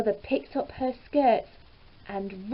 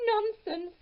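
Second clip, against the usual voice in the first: wife dismissing; 11 semitones higher than usual. A narrator's reading. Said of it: "said his wife".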